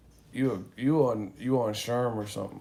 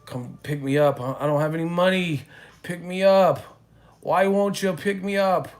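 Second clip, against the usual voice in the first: louder and higher, pushy whining plea; loud pleading; 7 semitones higher, 7 dB louder than usual. Retold or imitated. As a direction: imitate a frantic guy begging for a ride. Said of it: "talking hella loud".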